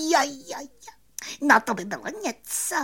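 very high, quick squeaky glee; excited cricket. A narrator's reading. Cricket thrilled at the prospect of fame, high quick excitement. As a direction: squeal it fast with delight. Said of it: "rozářil se Cvrček".

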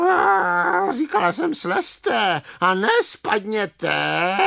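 high, drawn-out, peevish hen squawk; grumpy clucking. Acted. Hen scolding bumblebees who fell down, grumpy clucking. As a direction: cluck crossly, stretching the last word. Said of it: "kvokala mrzutě ta slepice".

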